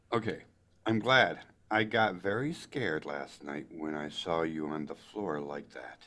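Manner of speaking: ordinary delivery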